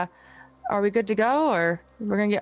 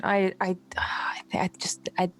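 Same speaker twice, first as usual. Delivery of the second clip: shaken whisper; 4 dB quieter than usual. Acted. Shaken after hearing voice.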